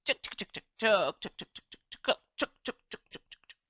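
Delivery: quick clucking tuk tuk that fades away; mild hen cluck imitation, trailing off. Shown as a demonstration. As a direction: cluck like a hen pecking, dying off at the end. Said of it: "and I make the sound".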